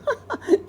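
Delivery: high little giggle; a quick girlish laugh; child giggling; girl's laugh. Acted. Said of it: "Sarah laughed".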